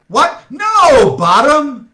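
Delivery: high, loud outraged cry; shouting in alarm. Acted. Director shouting at the actor who got the line wrong. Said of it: "shouted Peter".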